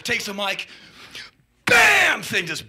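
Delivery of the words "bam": loud high smash; mock crash shout; loud bam. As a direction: slam it out. Smash onomatopoeia.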